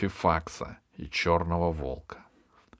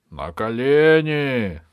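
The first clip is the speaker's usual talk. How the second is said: loud, drawn-out order, pitched up; ringing, stretched command; shouted command; 6 semitones higher, 10 dB louder, much slower than usual. Acted. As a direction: call it out like a royal servant commanding a prisoner. Valet orders the boy to kneel.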